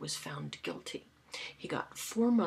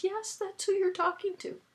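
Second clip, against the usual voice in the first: cheery confirmation; 13 semitones higher, 3 dB louder than usual. Retold or imitated. Retold cheery confirmation to caller.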